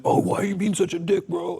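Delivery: high-pitched imitation of a complaint; mock complainer. Friend objecting to his tough boundaries, mock complaining. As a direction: put on a high, protesting voice. Mimics a complaining reaction to him.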